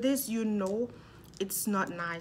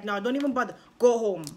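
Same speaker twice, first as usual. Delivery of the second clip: loud, stern mom ordering her home; firm order; 2 semitones higher, 7 dB louder, faster than usual. Retold or imitated. Mom refuses to let her fetch things.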